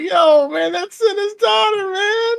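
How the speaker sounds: high, loud mock cry of protest; hammed-up high-pitched outrage; imitating myself shouting. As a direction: yelp it like a shocked friend, half laughing. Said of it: "I was like".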